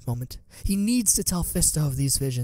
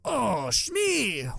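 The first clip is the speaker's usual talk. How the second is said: higher, slow, sly alien shopkeeper; Watto's voice; 9 semitones higher than usual. A narrator's reading. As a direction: probe with a greedy curiosity. Watto recalls Shmi.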